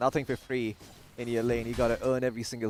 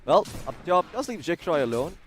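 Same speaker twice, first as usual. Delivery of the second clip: ordinary talk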